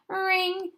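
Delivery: one loud, high "ring!"; phone-ring imitation. Reader voices the phone ringing again, high ring.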